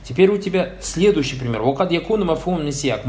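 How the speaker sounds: ordinary delivery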